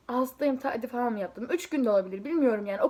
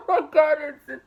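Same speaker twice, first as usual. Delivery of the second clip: laughing; 4 semitones higher, 8 dB louder than usual. Real, not acted. Laughing at embarrassing memory.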